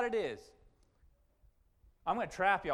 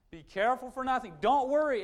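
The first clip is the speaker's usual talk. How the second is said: louder, higher, commanding; 6 semitones higher, 5 dB louder than usual. Shown as a demonstration. Pastor commanding the congregation, loud and high. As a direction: bark it as an order. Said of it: "It's a command".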